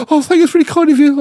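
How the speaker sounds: mock grateful woman. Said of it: "she was like".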